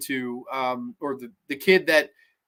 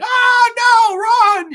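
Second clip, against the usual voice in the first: very high, loud drawn-out wail; hammed-up horrified scream; mock screaming; 10 dB louder, much slower than usual. Girlfriend seeing him turned into bread, exaggerated scream. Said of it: "the fucking girlfriend starts screaming".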